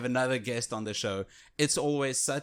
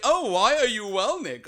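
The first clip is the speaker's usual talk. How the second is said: mock prompting; 10 semitones higher, 7 dB louder than usual. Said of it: "It's the obligatory".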